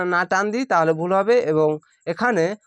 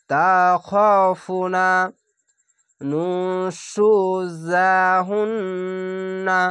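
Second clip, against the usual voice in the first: slow, drawn-out chanted recitation; Quranic recitation; much slower than usual. Shown as a demonstration. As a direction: recite each word slowly with tajweed. Recited demo.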